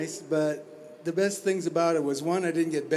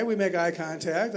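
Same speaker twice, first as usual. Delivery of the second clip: usual speaking voice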